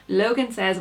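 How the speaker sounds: ordinary delivery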